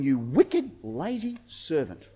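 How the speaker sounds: low, grave rebuke; acted stern judgement, sinking in volume; stern master. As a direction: drop low and slow down into a quiet condemnation. Master rebukes servant, low first take.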